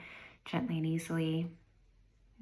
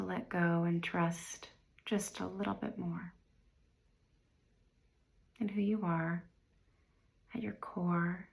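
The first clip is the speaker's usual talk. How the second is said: guided meditation. Real, not acted. Meditation guide inviting listeners to review the year, unhurried and calm. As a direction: guide them gently inward, leaving space after each phrase.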